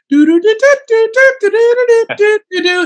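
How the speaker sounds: very high, loud cartoon woodpecker trill; squeaky high cartoon bird laugh; Woody Woodpecker call. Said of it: "I'm hearing Woody Woodpecker go".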